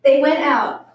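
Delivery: usual speaking voice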